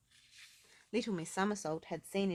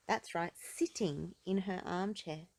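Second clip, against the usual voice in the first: usual speaking voice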